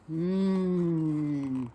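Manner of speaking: long drawn-out hum of delight; savouring mmm. Acted. Reader enjoying Mrs Smith's pie smell, long savouring hum.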